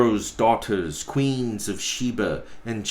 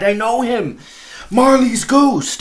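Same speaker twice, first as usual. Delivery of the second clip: much higher and louder cry; the flame shrieks in recognition; rising alarmed cry of the fire; 12 semitones higher, 9 dB louder than usual. Acted. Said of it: "as though it cried".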